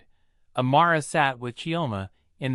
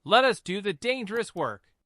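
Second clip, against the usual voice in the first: higher commander voice, firm and steady; countering firmly; 7 semitones higher than usual. A narrator's reading. Deputy commissioner to the blind mother, firm protective voice. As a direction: overrule her kindly but firmly. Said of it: "Deputy Commissioner Obi countered".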